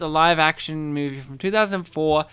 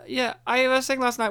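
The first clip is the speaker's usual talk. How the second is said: normal talking voice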